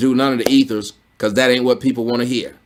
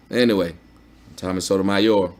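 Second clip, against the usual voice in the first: quiet wrap-up; 5 semitones lower, 6 dB quieter than usual. Real, not acted. Winding down to sign off.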